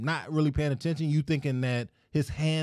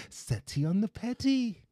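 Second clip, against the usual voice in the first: slow taunt; 7 semitones higher, 6 dB quieter than usual. Retold or imitated. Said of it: "You be like".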